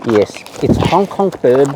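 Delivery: ordinary talk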